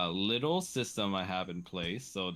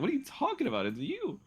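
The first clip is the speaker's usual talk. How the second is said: mock outraged; faster than usual. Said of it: "I was like".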